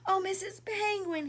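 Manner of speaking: high, loud little bird's plea; little bird crying out. Acted. Lost little bird crying to the giraffe, high and loud. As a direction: call out like a lost chick. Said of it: "he cried".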